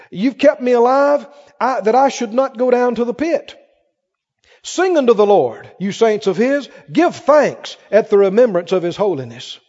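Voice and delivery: rising to loud, ringing proclamation; building preached scripture. Acted. Preacher climaxing the psalm before the church, building loud. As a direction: build it up to the morning.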